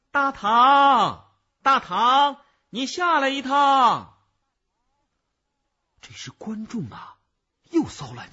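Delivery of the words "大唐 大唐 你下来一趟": 高亢洪亮地拖长声喊人; 放开嗓门朝银幕呼唤; 大声喊叫. A narrator's reading. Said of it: "又大声地喊了起来". (高声呼喊，句尾拖长)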